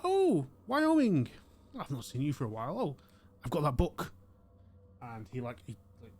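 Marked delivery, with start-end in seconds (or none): 0.0-4.1 put-on old gentleman lighting up at a regular; old shopkeeper greeting Wyoming, delighted; delighted old man.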